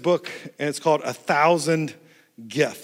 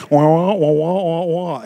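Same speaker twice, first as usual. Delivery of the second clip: mock gibberish; 5 dB louder than usual. Mimics unintelligible drive-through voice.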